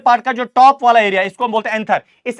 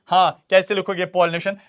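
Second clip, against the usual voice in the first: ordinary delivery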